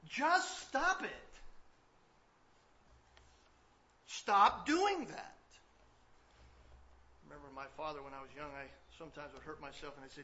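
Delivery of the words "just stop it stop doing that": loud, slow, emphatic preaching; fiery pastor pressing the congregation; preaching at full force. Acted. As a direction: drive the point home from the pulpit.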